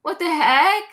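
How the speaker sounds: high, confused what the heck; baffled surprise. Retold or imitated. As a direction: act thrown by it. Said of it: "I was like".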